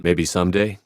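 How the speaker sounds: ordinary talk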